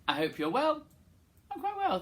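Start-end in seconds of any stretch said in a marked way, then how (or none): 1.5-2.0 squeaky high reply; high silly voice. Pretend. Answers own question in funny voice.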